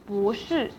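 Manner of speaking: 不 rising, then 是 falling; second tone on 不. Shown as a demonstration. Teacher repeating the example, rising tone on 不 before 是. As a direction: lift 不 and drop 是. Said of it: "不 in the second tone".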